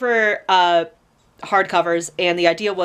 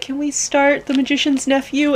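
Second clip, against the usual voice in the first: higher-pitched quote of an eager request; 7 semitones higher than usual. Retold or imitated. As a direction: ask it high, like a pleading kid. Quoting her younger self asking.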